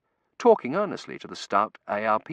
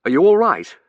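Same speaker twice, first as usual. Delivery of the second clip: loud, raised quick question; urgent concern; 11 semitones higher, 15 dB louder than usual. A narrator's reading. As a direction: anxiously check on someone pulled from rubble. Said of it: "we both said in unison".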